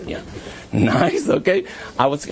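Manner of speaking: normal talking voice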